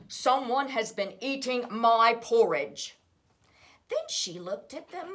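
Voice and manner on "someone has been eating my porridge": big, booming Papa Bear, much louder; great gruff bear voice. A narrator's reading. Preschool teacher voicing Papa Bear, loud and gruff. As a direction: boom it out like a huge bear. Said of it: "in his great, gruff, huge bear voice".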